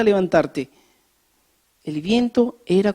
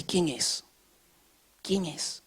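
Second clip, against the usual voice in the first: hushed asking; 13 dB quieter, slower than usual. Acted. Identificar la voz, preguntando.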